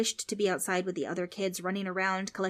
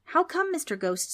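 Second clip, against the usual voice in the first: put-on nervous kid; 10 semitones higher, 4 dB louder than usual. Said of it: "so nervous and scared".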